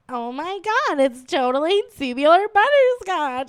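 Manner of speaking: put-on high Valley Girl gush, laughing; laughing Valley Girl squeal; Valley Girl accent. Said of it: "she'd go".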